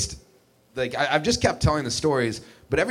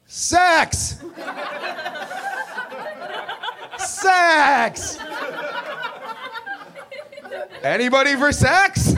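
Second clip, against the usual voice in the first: high, laughing mock yell out the window; much slower than usual. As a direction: shout it out gleefully with a laugh. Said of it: "and just yelled".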